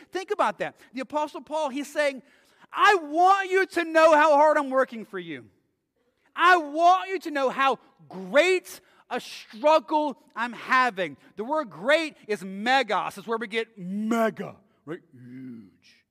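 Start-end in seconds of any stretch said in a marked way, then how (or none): 2.7-11.2 louder, pressing Paul's plea home; forceful preaching. Acted. Preacher voices Paul's plea to the church, loud and urgent. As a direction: drive it at the room.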